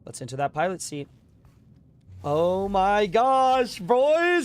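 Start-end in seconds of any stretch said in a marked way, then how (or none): 2.2-4.4 high, loud triumphant shout; excited yell. Real, not acted. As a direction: scream it out in triumph. Triumphant yell after the sale.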